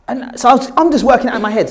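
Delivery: usual speaking voice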